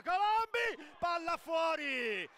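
sudden high shout of a chance; shouting commentary. Real, not acted. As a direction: yell it as the ball flies. Live goal chance, commentator shouts.

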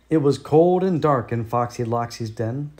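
ordinary delivery, nothing marked

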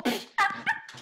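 high, voiceless wheezy laugh; odd laugh. Real, not acted. Friend cracks up at computer glitch, odd laugh. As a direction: let out a weird high cackle. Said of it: "What is that laugh?".

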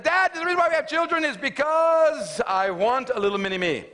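high, loud, grandly self-important proclamation; mock-wise young know-it-all. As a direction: expound to Dad as if wise beyond your years. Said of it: "I'm wise beyond my years".

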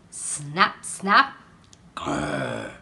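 snaps then a deep growl; snap, then growl low. A narrator's reading. Crocodile snapping then growling.

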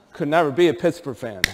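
ordinary talk, nothing marked

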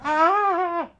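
very high, loud shriek; long mock scream of alarm; horrified scream. Daughter seeing kisses blown to the sun, shrieking. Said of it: "started screaming".

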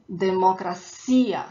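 democracia said firmly, stress on the ia; stress on final ia. Shown as a demonstration. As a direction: model de-mo-cra-CI-a for learners. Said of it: "is that ia at the end".

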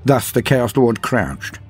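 usual speaking voice

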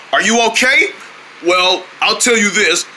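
usual speaking voice

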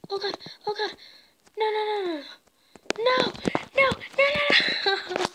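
oh no oh no, spiraling into panic; panicked. Real, not acted. Panics as he dies.

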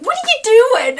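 very high calling voice, what are you doing; calls out in surprise across the platform; friend calling across. Retold or imitated. Friend calls across the stairs, high and surprised.

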